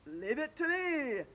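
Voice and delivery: slow, confident hero voice; teacher stepping forward, slow heroic; heroic announcement. Acted. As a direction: step up and announce it grandly. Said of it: "Mister Cooper announced".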